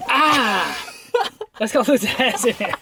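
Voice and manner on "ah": sharp laughing cry of pain; pained yelp. Real, not acted. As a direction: yelp as the tape rips. Tape ripped off beard.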